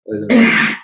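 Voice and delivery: bursting high and loud with delight; amazed. Real, not acted. Gushing to her friend about a great actor, excited and loud. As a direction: gush about it, voice leaping up.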